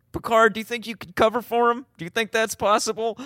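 high-pitched, tentative question; mock innocent, pitched right up; awkward hopeful asking. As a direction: ask it high, as if testing the waters. Acting out Sakath's awkward hypothetical question.

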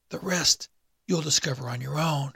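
a corpse croaking up from the abyss; the awakened dead man's dry rasp; dry raspy dead man. A narrator's reading.

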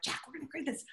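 high-pitched excited rush, speeding up; all excited. Retold or imitated. Pitching a certification idea to Jack, all excited. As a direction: re-enact bursting with a new idea. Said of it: "I'm all excited".

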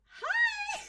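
very high sing-out hello; hammed-up sweet doorstep greeting; fake-cheery greeting. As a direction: greet the neighbors in a squealing high pitch. Villains greet neighbors at the door.

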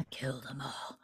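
slow, soft whisper after a sigh; whispered echo. Real, not acted. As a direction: breathe it out slowly, under your breath. Echoing co-host's remark under breath.

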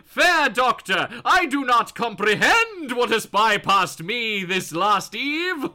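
put-on loud, slow old-timey theatrical voice; hammy stage actor. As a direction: declaim it like a Shakespearean actor. Patient voiced in old-timey English.

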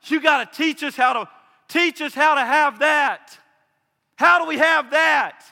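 loud pleading cry to be taught; disciples begging loud. Retold or imitated. Disciples begging Jesus to teach them, loud pleading cry. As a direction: beg the teacher loudly for the secret.

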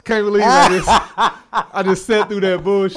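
loud, high words through laughter; real laughing disbelief. Real, not acted. Host after co-host's joke story, laughing hard. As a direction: crack up while complaining.

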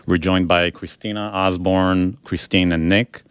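usual speaking voice

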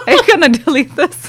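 laughing high and loud. Real, not acted. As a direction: ask it through a laugh. Embarrassed laugh.

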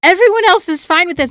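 loud, high, exasperated outburst; exasperated yell. Retold or imitated. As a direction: yell it in disbelief. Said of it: "And I'm just like".

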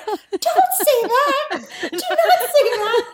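very high squealed warning, laughing; high-pitched, drawn-out retold plea; mock alarm. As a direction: imitate panicked friends while cracking up.

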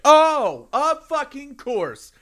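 high, slow, loud outburst; exasperated. Retold or imitated. Man whose headphones caught on a doorknob, high exasperated wail. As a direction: drag it out, world's against you. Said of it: "the world's against me".

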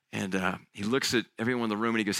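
normal talking voice

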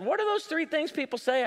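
put-on old lady voice. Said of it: "this sixty-five-year-old lady walks up to me and she said".

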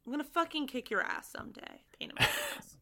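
hushed grumbling, fading out; grumbled threat. Real, not acted. Grumbled threat after teasing.

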